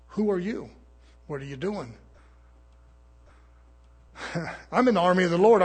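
ordinary talk

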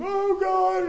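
very high, loud mock wail to God; long imitation wail of a desperate believer; mock crying out. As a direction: cry out in hammed-up desperation. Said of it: "you're gonna go cry out to Him".

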